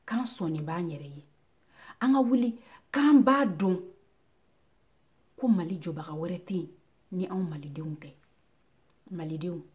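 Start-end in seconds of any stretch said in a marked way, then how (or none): 2.0-3.8 loud, high call to rise; ringing, raised declamation; rallying cry. Acted. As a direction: call the people to their feet. Rallying call in recited poem.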